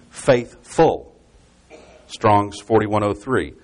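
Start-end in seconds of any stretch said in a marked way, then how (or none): 0.1-1.1 "faithful" slowly split into faith and full; emphasised faithful. Shown as a demonstration. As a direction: give each half of "faithful" its own weight. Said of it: "as the word really means".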